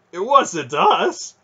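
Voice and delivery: high, loud panicked denial; frightened soldier pleading innocence; hasty protest. A narrator's reading. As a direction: protest your innocence, alarmed. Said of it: "one of the soldiers said quickly".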